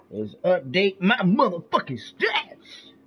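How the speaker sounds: high, slow, loud put-on voice; tripping. Real, not acted. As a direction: stretch it out, goofy and high. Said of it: "I don't know why I'm talking like that".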